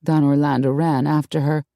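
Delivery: ordinary delivery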